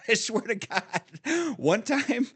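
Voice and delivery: laughing it out high; giggling in disbelief. Real, not acted. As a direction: swear it while cracking up.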